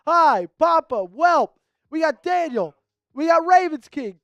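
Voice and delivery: normal talking voice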